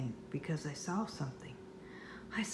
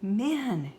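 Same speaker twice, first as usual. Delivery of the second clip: calling from the table; yelling; 5 semitones higher, 6 dB louder than usual. Acted. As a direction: call out loud across the house. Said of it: "yelled Mom".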